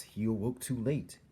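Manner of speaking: plain reading voice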